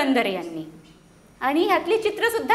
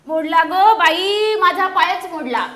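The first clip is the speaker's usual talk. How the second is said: slow, drawn-out cry, a bit louder; 2 semitones higher, 8 dB louder, slower than usual. A narrator's reading. Centipede crying out after tripping on a stone. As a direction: wail about the broken leg. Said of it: "गोम ओरडली".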